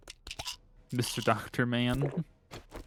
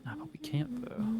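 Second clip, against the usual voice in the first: higher whispered aside; 7 dB quieter than usual. Real, not acted. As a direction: breathe it out, unvoiced. Quiet aside while playing.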